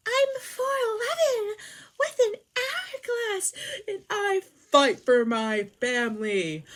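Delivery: high, loud, slow whiny wail; hammed-up squeaky boasting, every word drawn out; mock tiny heroine. Tiny heroine boasting of fighting for her family, mock squeaky bravado.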